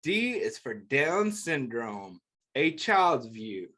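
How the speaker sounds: gentle picture book read-aloud; reading to children. Acted. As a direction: read it like a bedtime book for kids.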